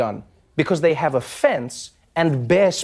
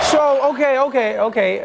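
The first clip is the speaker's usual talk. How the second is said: awkward senator voice; 8 semitones higher than usual. Retold or imitated. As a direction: nervously hurry things along.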